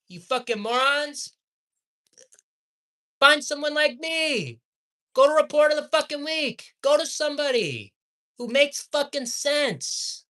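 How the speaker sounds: high-pitched exasperated ranting; heated. Real, not acted. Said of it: "I'm getting heated".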